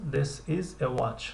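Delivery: very slow, careful answer; slow model answer. Shown as a demonstration. Teacher drills the answer slowly for students. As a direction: lay out each word for learners. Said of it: "teacher models answer".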